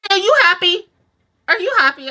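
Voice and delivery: loud, high confrontational question; accusing demand. Acted. Viewer confronting the character responsible, accusing demand. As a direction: throw it at them as an accusation.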